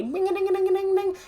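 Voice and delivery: very high, soft sing-song ding; ding it out like sparkling wand magic; magic wand chime. Acted. Magic wand zapping the rival, high tinkling dings. Imitating a magic wand's sound.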